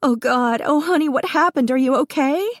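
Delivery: mother panicking over her burned son; frantic. A narrator's reading. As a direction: pour out panic over the hurt boy.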